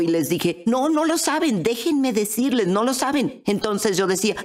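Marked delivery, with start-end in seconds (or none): 0.7-3.4 higher, urgent contradiction; retold insistence. Mother contradicting her born-again family, insistent. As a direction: insist earnestly, pitch lifted.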